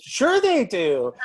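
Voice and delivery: slow, high, loud enthusiastic yes; drawn-out eager yes. Retold or imitated. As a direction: stretch out an overjoyed sure.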